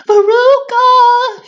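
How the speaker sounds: very high, loud, slow drawn-out call; mother shouting down the hole for her daughter. Acted. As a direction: call her name far down into the chute. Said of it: "shouted Missus Salt".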